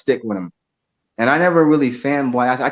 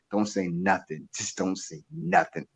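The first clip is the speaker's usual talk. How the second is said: hushed warning; 5 semitones lower, 11 dB quieter than usual. Retold or imitated. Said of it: "his staff members were like".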